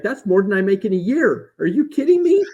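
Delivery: high, loud panicky outburst; mock panicked client, voice cracking high; retold panic. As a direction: imitate the client's panic, pitch shooting up. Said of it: "jumps up out of his seat and, you know, is having a panic attack".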